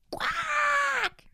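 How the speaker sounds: very high screeching squawk; parrot screech. Acted. Startled parrot screeching at the talking plant. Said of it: "kreischte der Papagei".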